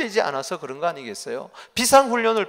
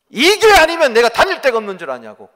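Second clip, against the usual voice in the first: higher, louder mock outraged yell; hammed-up shouting at the pastor; retold furious mother, loud and high; angry mother's yell; 6 semitones higher, 12 dB louder than usual.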